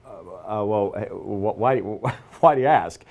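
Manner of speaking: mock-flustered stammer, stalling for words; caught off guard. Recognized by a stranger on a plane, flustered stammering. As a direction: stumble and restart, then a nervous laugh. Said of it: "he kinda caught me off guard".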